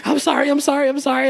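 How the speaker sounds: high, rushed sorry-sorry-sorry; hammed-up frantic apology; mock panicked apology. Late assistant begging forgiveness, high mock panic. Girl apologizing in retold story.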